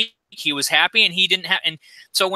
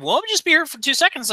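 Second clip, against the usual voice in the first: mock driver excuse; 10 semitones higher than usual. Driver blocking his drive quoted.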